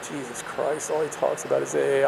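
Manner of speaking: quiet grumbling aside, as if thinking it; retold muted exasperation. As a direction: grumble it softly to yourself. Retold private exasperation at sponsor.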